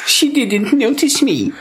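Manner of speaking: high, drawn-out mock whine; whiny. Mocking the film's lovesick apprentice, whiny. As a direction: whinge it slowly up high like a sulky apprentice.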